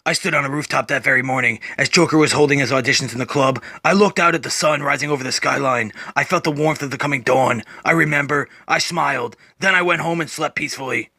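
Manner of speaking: deep, low, brooding Batman voice; Batman narrating. Acted. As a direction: growl it low like a weary vigilante. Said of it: "Batman then narrates".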